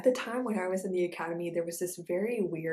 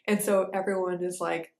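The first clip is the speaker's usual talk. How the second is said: ordinary talk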